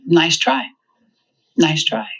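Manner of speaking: flat unbothered "Nice try, nice try"; dry, amused calm; deadpan. Retold or imitated. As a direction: brush it off calmly, no reaction. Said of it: "one of my favorite phrases".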